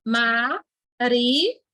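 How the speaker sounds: "Maria" broken as ma, ri, a; "Maria" spelt out slowly in syllables. Shown as a demonstration. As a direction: separate each syllable of "Maria" with a pause. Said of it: "que se separa".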